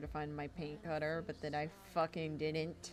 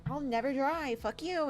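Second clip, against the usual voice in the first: high, loud, sneering voice of the paint; mock the stubborn paint; taunting watercolor; 9 semitones higher, 7 dB louder than usual. Artist voicing watercolor taunting her, high loud sneer.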